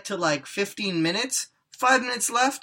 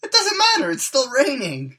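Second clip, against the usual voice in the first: loud, high insistence; insistent; 10 semitones higher, 7 dB louder than usual. Real, not acted. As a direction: argue it's still raining. Silly argument about rain.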